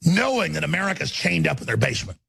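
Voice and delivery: ordinary delivery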